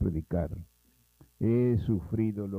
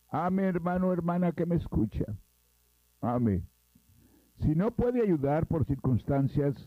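fervent exhortation; 7 semitones higher than usual. Acted. Preacher exhorts his listeners.

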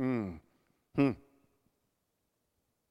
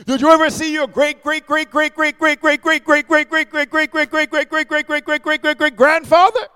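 higher, louder rapid string of greats; mock incredulous challenge; 7 semitones higher, 6 dB louder than usual. Preacher challenges a skeptic, higher rapid mocking list. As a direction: pile up the greats to mock the doubter.

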